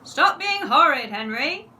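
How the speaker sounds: loud scolding shout. A narrator's reading. Mum shouts at Henry, loud and scolding. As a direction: shout it at the naughty son. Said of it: "shouted Mum".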